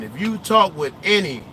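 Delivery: normal talking voice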